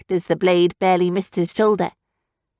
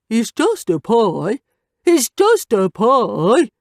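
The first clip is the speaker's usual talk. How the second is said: slow sing-song mockery of just; mocking; 3 semitones higher, 3 dB louder, much slower than usual. A narrator's reading. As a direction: mimic her words nastily. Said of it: "Scout mocked".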